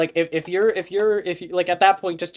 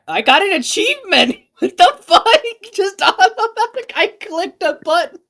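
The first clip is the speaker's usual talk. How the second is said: much higher, loud, laughing surprise; excited squeal; 9 dB louder than usual. Real, not acted. Streamer surprised by a sudden achievement, squealing.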